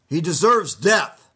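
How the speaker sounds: loud, slow verdict; loud condemning crowd. Acted. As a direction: hurl the death sentence. Council condemns Jesus.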